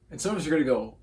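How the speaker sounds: ordinary talk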